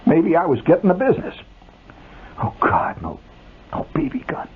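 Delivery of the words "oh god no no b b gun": despairing whisper. A narrator's reading. Kid's private despair.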